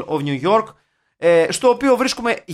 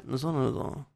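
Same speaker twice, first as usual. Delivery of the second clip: scheming film producers; 4 semitones lower than usual. Acted. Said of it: "και λέγανε".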